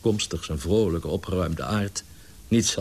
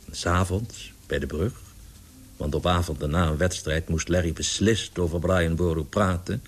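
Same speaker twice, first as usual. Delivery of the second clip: ordinary delivery, nothing marked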